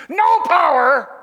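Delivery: NO POWER loud, high and drawn out; emphatic preacher. Shown as a demonstration. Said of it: "do that with me".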